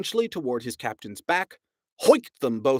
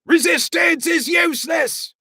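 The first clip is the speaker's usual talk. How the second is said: much higher, loud alien bellow; bellowing guard; 8 dB louder than usual. A narrator's reading. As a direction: bellow it mechanically. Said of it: "bellowed the guard".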